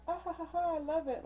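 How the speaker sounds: high, fake airy laugh into gushing; mock delight. Reader mimicking someone brushing off hurtful words, mock delight. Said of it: "sit there and be like".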